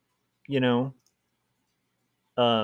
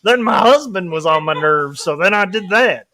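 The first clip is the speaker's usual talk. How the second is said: higher, breezy put-on voice with a laugh; mock casual killer; 4 semitones higher, 4 dB louder than usual. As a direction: confess a crime like it's nothing. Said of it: "Yeah, it's like".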